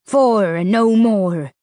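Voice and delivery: slow, drawn-out creature voice; slow ferryman rasp. A narrator's reading. Ferryman Rubers promising passage, slow and menacing. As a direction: draw it out like a river monster. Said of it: "he repeated".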